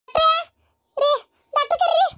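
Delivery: very high-pitched cry for help; urgent calling out. Acted. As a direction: call out urgently for the doctor. (ಗಾಬರಿಯಿಂದ ಕೂಗುವುದು)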